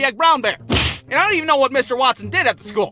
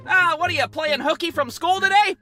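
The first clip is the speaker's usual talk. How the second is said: put-on mild drunk uncle; 6 semitones higher than usual. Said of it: "drunk Uncle Rick would say".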